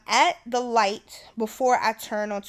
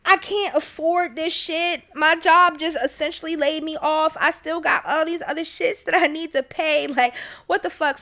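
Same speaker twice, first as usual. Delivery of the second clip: higher, tearful retold complaint; half-crying vent to mom; a mild replay of a tearful rant; upset rant; 6 semitones higher, 4 dB louder than usual. Upset call to mom after breakdown.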